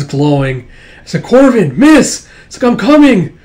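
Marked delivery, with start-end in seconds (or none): 1.2-2.3 high, loud cry for attention; urgent calling out. Acted. Kipper running up to miss, urgent high shout. 2.7-3.4 high, loud shout on the run; urgent calling out. Acted. Kipper running to help miss, urgent high shout.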